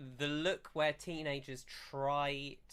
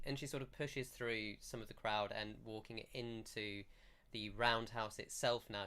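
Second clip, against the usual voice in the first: ordinary delivery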